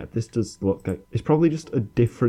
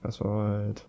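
ordinary delivery

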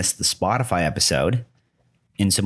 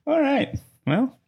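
surprised squeak; 9 semitones higher, slower than usual. Real, not acted. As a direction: voice jumps way up in surprise. Amused reaction to "a J.

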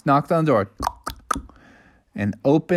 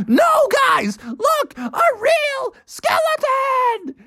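frantic warning; much slower than usual. A narrator's reading. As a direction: warn them frantically. Bubby warns others.

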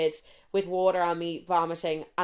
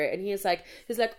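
ordinary delivery

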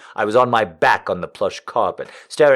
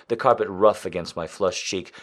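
ordinary delivery

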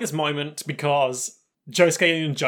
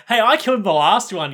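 louder, higher indignant protest; mock anger; 6 semitones higher, 6 dB louder than usual. Josuke getting angry at Jotaro over the bullets, mock indignant. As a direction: act out getting annoyed, playing it up. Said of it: "starts getting angry".

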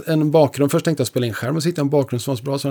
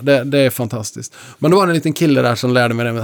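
ordinary talk, nothing marked

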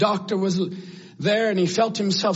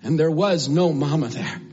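ordinary talk

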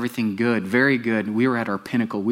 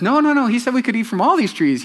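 high, louder insistent woman's voice; Eve protesting; 12 semitones higher, 5 dB louder than usual. Acted. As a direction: Eve correcting the serpent, eager.

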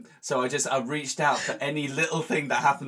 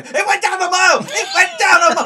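panicked yell, much higher and louder; shouting; 13 dB louder than usual. Retold or imitated. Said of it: "Why are you shouting".

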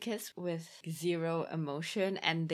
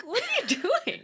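much higher, laughing outburst; exasperated laughing cry; 6 dB louder than usual. Retold or imitated. Viewer yelling at Nancy in the film, laughing. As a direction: squeal it at the screen in disbelief. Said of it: "And I'm like".

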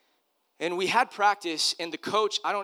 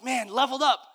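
higher, louder burst of confidence; retold elation; 8 semitones higher, 6 dB louder than usual. Eight-year-old after dad's coaching, excited and confident. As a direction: punch it up as a proud realization. Boy's thrill at new confidence.

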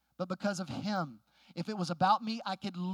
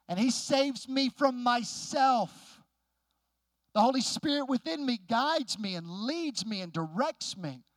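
emphatic preaching with punched key words; 2 semitones higher, 4 dB louder than usual. Real, not acted. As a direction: preach it with conviction, driving each phrase. Preaches to the congregation.

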